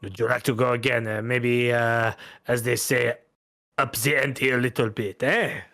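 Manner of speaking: courteous Bretonnian gambler proposing a wager; French-accented gambler. Acted. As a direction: coax politely in a French accent.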